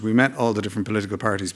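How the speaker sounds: ordinary talk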